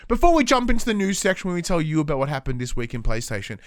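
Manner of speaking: normal talking voice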